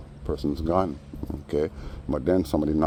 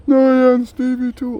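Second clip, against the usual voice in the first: very high falsetto whine, much louder; mock fake crying; 10 dB louder than usual. Teller mimics the man acting like he's crying, hammed-up falsetto. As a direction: ham up a fake weepy lament. Mocking the guy's reply.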